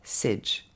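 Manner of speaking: SAGE said as a short, unstressed "sedge"; soft, slow "sedge" for SAGE; isolated second syllable. Shown as a demonstration. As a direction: give SAGE alone with a short "eh". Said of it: "ensuite c'est juste".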